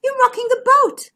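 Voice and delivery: high, loud, alarmed protest; crying out. A narrator's reading. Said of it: "cried Bertie".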